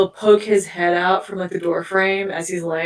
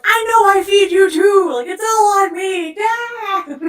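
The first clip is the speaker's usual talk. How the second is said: pitched way up, pleading; high, loud, whiny apology to the cat; high mock whine; 12 semitones higher, 5 dB louder, slower than usual. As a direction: squeak it like a guilty owner. Talking back to her cat.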